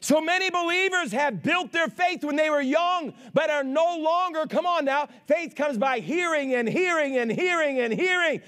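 full-force preaching. Real, not acted. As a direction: punch each phrase out to the congregation.

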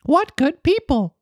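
high, trusting puppet voice; high trusting boy; naive Pinocchio. Acted. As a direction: think it with naive delight. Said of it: "thought Pinocchio to himself".

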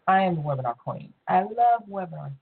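ordinary delivery

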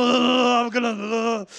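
drawn-out mock growl; slow, garbled snarl of rage. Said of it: "the more he started growling".

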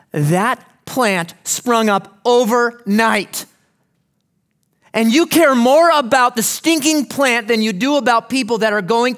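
loud, high pulpit fervour; preacher rebuking the congregation through Jonah, loud and fervent; preaching at full force. Acted. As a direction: drive it home with rising fire.

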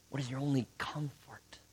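soft hushed whisper of the question. Pretend. The catechism gently asking the listener, whispered. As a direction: breathe the question like a quiet secret. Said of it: "The catechism would probably say".

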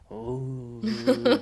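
high, loud excited exclamation; delighted "uy". Retold or imitated. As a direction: act out delight at a great record. Acts delight at a favourite record.

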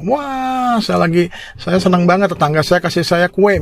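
acted delight with a long, high "wah"; happy exclamation. Happy person sharing news of a neighbor's cake, acted delight. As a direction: gush like someone thrilled by a gift. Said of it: "satu orang lagi bahagia nih".

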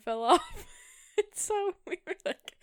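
talking through giggles; laughing. Real, not acted. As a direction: laugh while you speak. Laughing while telling the frog story.

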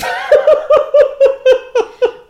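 loud, high burst of laughter; real cackling laugh. Real, not acted. Reader amused by Anne's absurd line, real cackle. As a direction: crack up in a long pealing laugh.

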